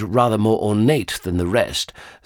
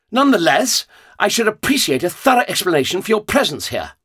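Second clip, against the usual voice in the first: haughty gentleman; 11 semitones higher, 4 dB louder, faster than usual. A narrator's reading. Doctor demands explanation.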